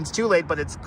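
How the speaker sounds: normal talking voice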